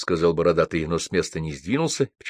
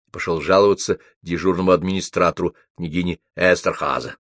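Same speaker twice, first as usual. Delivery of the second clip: plain reading voice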